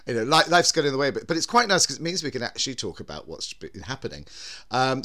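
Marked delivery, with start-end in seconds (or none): none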